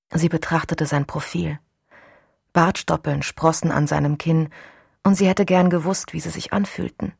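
ordinary delivery

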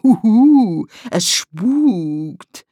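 loud, swooping ghostly hoot; mock ghost. A narrator's reading. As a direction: howl it like a spook to tease. Tom äfft ein Gespenst nach. (spöttisches Gespensterheulen)

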